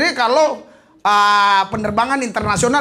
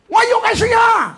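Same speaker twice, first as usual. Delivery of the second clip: high, loud, slow mock foreign shout; hammed-up shouted order; mock officer's call; 5 dB louder, slower than usual. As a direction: call out like the officer waving a taxi. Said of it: "panggil taksi saya".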